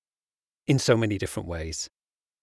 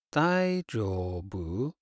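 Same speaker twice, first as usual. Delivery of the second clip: slow; 3 semitones lower, 4 dB quieter, much slower than usual. Shown as a demonstration. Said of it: "many situations".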